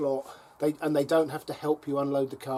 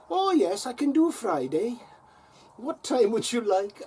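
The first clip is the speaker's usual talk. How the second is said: imitated Welsh lady, higher and sing-song; chirpy Welsh accent, pitched well up; Welsh accent again; 9 semitones higher, 3 dB louder than usual. Welsh receptionist offering a Friday slot, higher imitated Welsh accent. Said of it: "that is so Welsh".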